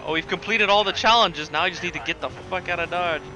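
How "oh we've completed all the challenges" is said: ordinary talk, nothing marked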